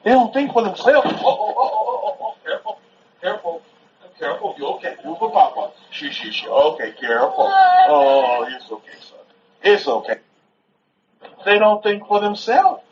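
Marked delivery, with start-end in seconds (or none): 1.1-10.2 hushed, gentle aside to a little one. Real, not acted. Father to his son after a little fall, soothing. Soothing a child who stumbles.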